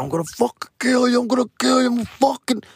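retold frantic threat. Brother fighting family in the car, high frantic threat. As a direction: hurl the threat in a high, panicked rush.

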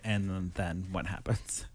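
hushed whisper. Real, not acted. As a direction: whisper it like a secret. Whispering about notes.

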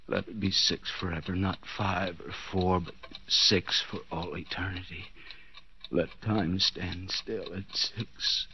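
cradle the number like a treasure; hushed delirious plea; dreamy hush. Acted. Delirious pleading with time.

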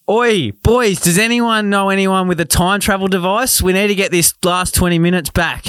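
mock on-field sledge; taunting the fielders; bad acting. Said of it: "deliver it like we're out in the ground".

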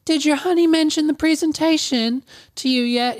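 high put-on receptionist voice; Susan. Receptionist asking a guest about the presentation, coaxing impression. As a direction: pitch it well up, sweetly prying. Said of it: "my voice impression keeps changing".

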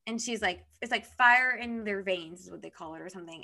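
ordinary talk

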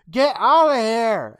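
high, drawn-out mock yell; retold yell. Girl driving two kids out of her hole, yelling. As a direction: yell it loud and slow, acting her out. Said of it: "and then she yells".

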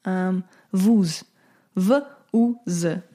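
vuz said as one Russian word, then spelled V U Z slowly letter by letter; Russian acronym vuz. Shown as a demonstration. Teaching viewers a Russian acronym, careful slow spelling. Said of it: "this is a little Russian lesson".

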